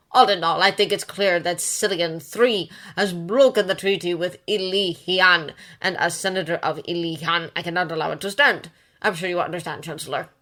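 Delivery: loud, pompous nasal senator. A narrator's reading. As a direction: press the point, voice climbing. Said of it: "his nasal voice picking up a few octaves".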